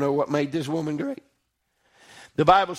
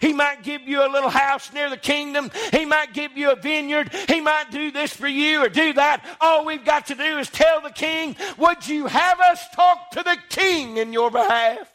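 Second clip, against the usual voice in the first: high, raised preacher's voice climbing on each offer; preaching at full force; 8 semitones higher than usual. Acted. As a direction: preach it out, lifting the congregation. Preacher builds toward the king's offer.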